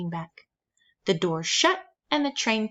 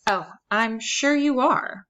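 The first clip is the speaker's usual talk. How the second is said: dry sarcastic granny; 4 semitones higher, 4 dB louder, slower than usual. A narrator's reading. Grandmother mocking the caseworker.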